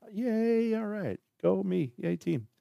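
high, soft little self-cheer; mock cheer. Celebrating own podcast ranking, hushed mock cheer. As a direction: cheer yourself on in a small, high voice.

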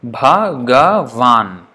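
Bhagavan drawn out very slowly, syllable by syllable; syllables spelled out. Shown as a demonstration. Teacher counting syllables for students, very slow and deliberate. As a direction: stretch Bhagavan out slowly so each syllable is heard. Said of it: "Bhagavan has how many syllables".

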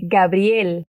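Gabriel with a clearly sounded L at the end; careful teacher Gabriel ending in a clean L; clear final L. Shown as a demonstration. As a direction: finish Gabriel on a crisp L. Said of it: "Atención a la L".

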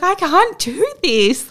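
high, loud, slow despairing wail; panicked labouring mother; desperate plea. Retold or imitated. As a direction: cry out that you can't go on. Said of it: "I was kind of losing it".

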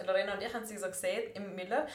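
normal talking voice

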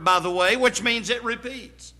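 ordinary talk

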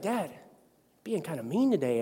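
daughter's complaint. Retold or imitated. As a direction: say it quietly like a hurt kid. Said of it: "she's like".

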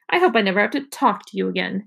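ordinary delivery

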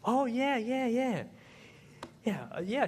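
high, stalling yeahs; caught-out husband. Retold or imitated. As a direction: bluff through not remembering. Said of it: "husband caught out".

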